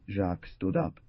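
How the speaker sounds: usual speaking voice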